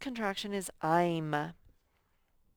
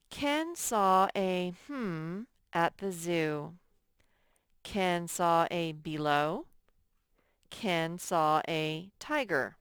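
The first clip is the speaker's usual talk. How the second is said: slow read-aloud; 3 dB louder, slower than usual. Shown as a demonstration. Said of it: "teacher reads sentence slowly, testing words".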